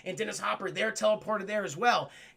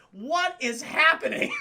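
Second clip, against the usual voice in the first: slow, high, loud disbelief; incredulous outburst; 6 semitones higher, 5 dB louder, slower than usual. Retold or imitated. Viewer reacting to the gruesome death, loud disbelief. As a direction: stretch it out in baffled amazement. His astonished reaction, retold.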